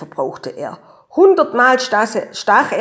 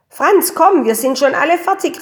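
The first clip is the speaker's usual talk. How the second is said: put-on higher, brisk call to a child; 6 semitones higher, 4 dB louder than usual. A narrator's reading. Mama calling Franz for the walk, calling out. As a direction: call him out of his room. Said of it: "rief die Mama".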